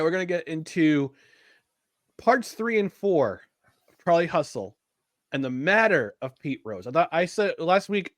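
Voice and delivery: usual speaking voice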